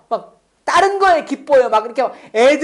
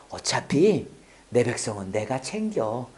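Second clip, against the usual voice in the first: hushed, low voice of God reassuring; soft, unhurried divine reply; God's quiet voice; 9 semitones lower, 6 dB quieter than usual. Retold or imitated. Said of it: "음성이 많이 들리거든요, 하나님한테". (낮고 조용한 하나님의 음성)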